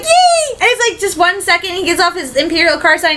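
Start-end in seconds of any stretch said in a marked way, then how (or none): 0.0-0.6 squealed yay. Retold or imitated. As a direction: squeal it with joy. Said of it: "I'm like".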